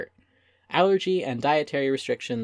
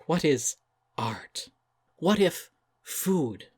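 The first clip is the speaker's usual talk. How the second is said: mock profound; slower than usual. Mocking chef's pretentious questions.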